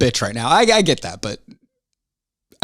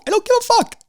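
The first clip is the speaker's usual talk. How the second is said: exasperated peak. Real, not acted. Host ranting at listeners who complain, strained high outburst. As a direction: voice shoots far higher, strained.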